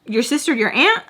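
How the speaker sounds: high-pitched, provoking questions; mouthy taunting. Retold or imitated. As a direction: needle them with pointed questions. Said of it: "I was talking to her like that".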